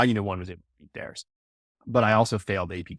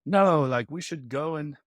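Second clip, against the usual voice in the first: higher-pitched, badly faked English aristocrat accent; mock aristocratic accent; 5 semitones higher than usual. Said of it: "really bad English accent".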